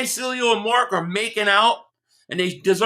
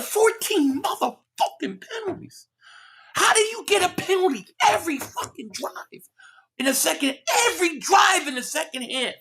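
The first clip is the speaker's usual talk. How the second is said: real frustrated yell; 7 semitones higher than usual. Real, not acted. Host fuming to viewers over penalties, cracking angry shout. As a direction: rage at the penalties, voice breaking up high. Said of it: "Sorry, I'm getting pissed".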